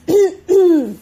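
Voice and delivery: a loud, pointed throat clear. Acted. Husband entering clears his throat at crying wife. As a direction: clear the throat to announce yourself. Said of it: "त्याने घसा खाकरला".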